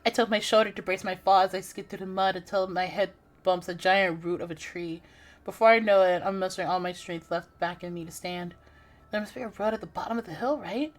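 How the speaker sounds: steady, hushed first-person narration; story narration. Acted. Gamer reading her horror game aloud, hushed narration. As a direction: read the horror scene like a storybook.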